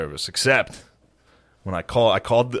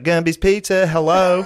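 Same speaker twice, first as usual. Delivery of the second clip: high put-on Southern drawl; Southern accent; 11 semitones higher, 7 dB louder, slower than usual. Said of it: "Zach Galifianakis steal his southern accent".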